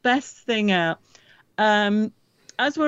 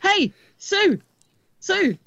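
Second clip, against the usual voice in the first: calling out; 10 semitones higher, much slower than usual. Retold or imitated. Tim Peake calls across room.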